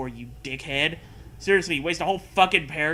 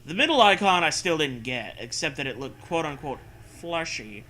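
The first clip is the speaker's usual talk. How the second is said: steady read-aloud narrator voice; story narration; 5 dB louder than usual. Acted. Reads the story text aloud.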